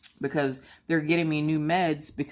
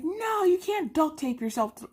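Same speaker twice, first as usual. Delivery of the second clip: high-pitched, stretched-out incredulous no; exasperated refusal; 12 semitones higher than usual. Retold or imitated. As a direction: shoot down the child's silly idea. Exasperated mother refusing child's idea.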